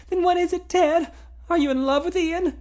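put-on young woman voice. A narrator's reading. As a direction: ask her rival question anxiously. Female character voiced.

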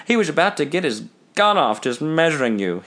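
plain reading voice

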